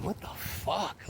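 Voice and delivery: whispered, slow what the fuck; slow whispered disbelief; stunned whisper. Retold or imitated. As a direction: hush it out in shock as a kid. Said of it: "I was just going".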